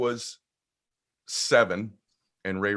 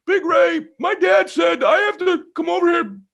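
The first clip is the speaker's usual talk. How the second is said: put-on very high shouted child voice; boy explaining to the neighbor why he came, high shout; little boy shouting; 6 dB louder than usual. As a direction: yell it up at the big neighbor like a kid. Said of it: "I go".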